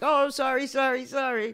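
high, mock-sheepish repeated sorry; teasing apology. Canadian mock-apologizing to needle the angry stranger. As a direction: apologize on purpose to annoy him. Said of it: "Now I'm just saying it to piss you off".

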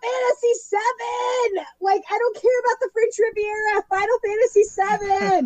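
hammed-up fan shriek at the trailer; ecstatic shout. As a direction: shriek it like your dream came true. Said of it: "I'm just like".

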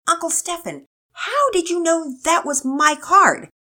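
put-on high, loud, drawn-out kid's question; high-pitched, insistent child; amazed child. A narrator's reading. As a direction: demand the secret like an astonished boy. Amazed boy questions uncle in story.

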